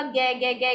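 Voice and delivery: "गे गे गे", first sound repeated in even beats; stuttering. Shown as a demonstration. Teacher demonstrates a child stuttering on गाड़ी, "गे गे गे". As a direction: get stuck repeating the opening "गे गे गे". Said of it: "कई बार दोहराता है".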